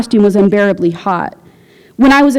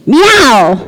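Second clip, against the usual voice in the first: angry meow; 3 semitones higher, 5 dB louder than usual. A narrator's reading. Angry quarrel.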